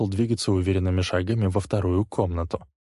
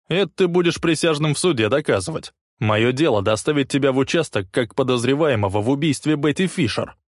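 loud, higher, firm insistence; insisting; 6 semitones higher, 4 dB louder than usual. A narrator's reading. Leslie insisting on arresting Rick, loud firm insistence. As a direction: press the point, not yielding. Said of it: "настаивал Лесли".

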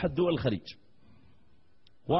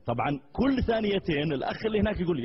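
ordinary talk, nothing marked

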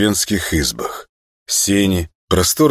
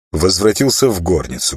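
ordinary delivery, nothing marked

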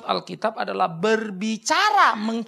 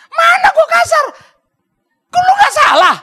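high, shouted mock protest; hammed-up yelling in self-defense; loud voice; 12 dB louder than usual. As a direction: bellow your innocence. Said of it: "Maksudnya suara om keras".

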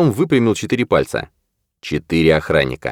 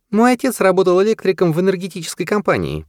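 lightly raised woman's voice; female character voice; 12 semitones higher than usual. A narrator's reading. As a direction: voice her a little higher. Washburn explains, high voice.

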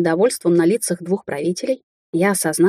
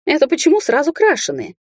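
high-pitched offended question; indignant; 9 semitones higher than usual. A narrator's reading. Witch objects to insult about her hair, indignant. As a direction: protest with a rising, affronted voice. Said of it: "возмутилась я".

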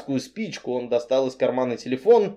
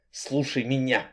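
barely contained fury; 7 dB quieter than usual. Acted. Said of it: "едва сдерживая бешенство, рявкнул".

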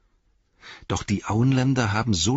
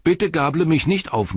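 high-pitched, hurried hail; called out; 9 semitones higher, 4 dB louder than usual. A narrator's reading. Rider pleading not to be pitchforked, high calling voice. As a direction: call out so nobody attacks you. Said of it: "rief Sam".

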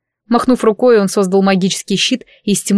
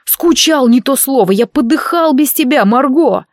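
strained, higher, out of breath; choking wheeze; 4 semitones higher than usual. Acted. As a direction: gasp it out while choked. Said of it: "Дарк, задыхаясь, прохрипел".

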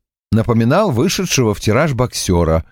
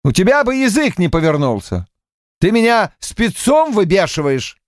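high, worked-up accusing tone; getting hot under the collar; heated and indignant; 8 semitones higher than usual. A narrator's reading. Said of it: "Полвард мало-помалу закипал".

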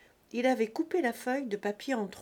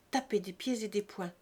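ordinary delivery, nothing marked

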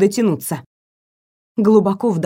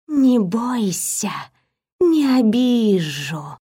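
slow hypnotic serpent hiss; much slower than usual. A narrator's reading. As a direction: hiss it gently like a coaxing serpent. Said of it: "прошипела змея". (медленное гипнотическое шипение змеи)

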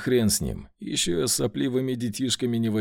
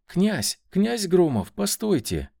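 higher-pitched urgent calling out; girl's cry; 7 semitones higher, 4 dB louder, slower than usual. A narrator's reading. Girl hailing the prince from down the corridor, crying out. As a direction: call after someone down a corridor. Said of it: "девичий крик из глубины коридора".

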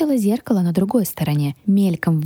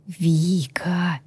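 drawn-out, consoling whisper. A narrator's reading. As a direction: whisper the name tenderly. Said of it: "прошептал хранитель". (ласковый протяжный шёпот)

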